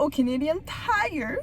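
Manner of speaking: Canadian Tire in a Québécois accent, last word drawn out; weird Québécois accent. Real, not acted. Vlogger signing off until the store, Québécois accent. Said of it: "Je dis ça vraiment weird".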